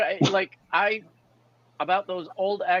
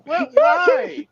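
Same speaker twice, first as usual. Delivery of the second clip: mock dismay; 13 semitones higher, 5 dB louder than usual. Mock dismay about cereal.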